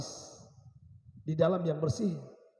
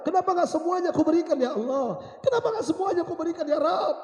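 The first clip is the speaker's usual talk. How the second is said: high, loud anguished wail; impassioned lament, pitched high; crying with regret; 12 semitones higher, 6 dB louder than usual. Acted. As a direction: cry out to God in remorse. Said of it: "Dia menyesal".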